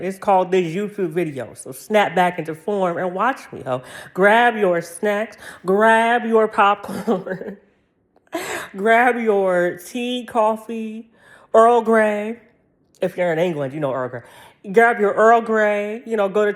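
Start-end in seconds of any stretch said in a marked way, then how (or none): 4.1-12.4 drawn out. Acted. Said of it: "announcing the show to viewers".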